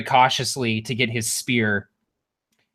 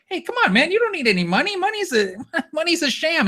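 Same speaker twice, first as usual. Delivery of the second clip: very high, whiny-silly voice; comic high-voiced pleading; mock carefree victim; faster than usual. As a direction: protest in a silly high-pitched voice. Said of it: "And you're like".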